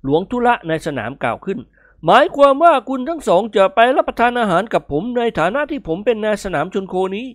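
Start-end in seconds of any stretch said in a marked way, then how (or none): 1.8-7.3 high, loud official; hopeful arena manager. Acted. Arena manager assuming he wins the guests, hopeful. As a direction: claim the guests as yours.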